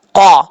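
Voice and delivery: قا from the back of the tongue, full and heavy; heavy qaf demo. Shown as a demonstration. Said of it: "کیسے پڑھیں گے؟". (قا کو پر اور زور سے)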